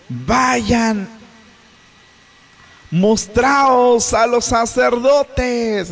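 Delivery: slow, high, stretched-out holler; calling out from afar. Acted. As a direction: shout the order across a distance. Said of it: "les gritó".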